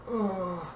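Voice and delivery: dazed little moan; groan. A narrator's reading. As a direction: groan faintly on coming to. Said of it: "Padme groaned".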